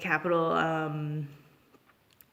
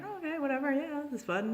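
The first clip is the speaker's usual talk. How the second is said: high, breezy mock-casual voice; a touch of tipsy nonchalance; 9 semitones higher, 3 dB quieter, faster than usual. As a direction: shrug it off lightly. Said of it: "we're kinda like".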